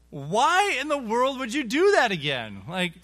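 high, loud, aggrieved questioning; acted outrage, pleading for an explanation; indignant protest. Victim confronts the man who hit him again, indignant protest.

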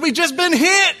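high-pitched, loud comic wail; alarmed shout. Retold or imitated. Driver telling his passenger about the crash, mock-panicked shout. Retold cry after the crash.

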